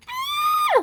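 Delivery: piercing high squeal; scream. A narrator's reading. Annie is pushed along with Percy, scream. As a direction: shriek as high as possible. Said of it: "screamed Annie".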